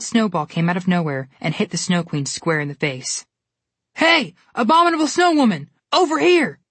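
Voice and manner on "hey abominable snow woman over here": high, loud taunting shout; taunting yell. A narrator's reading. Connor yelling to draw the Snow Queen away, loud and taunting. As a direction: bait her from across the crater. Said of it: "Connor yelled at her".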